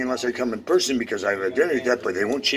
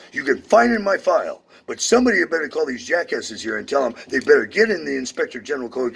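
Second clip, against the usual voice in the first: usual speaking voice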